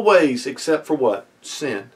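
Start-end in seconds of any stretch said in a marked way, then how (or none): none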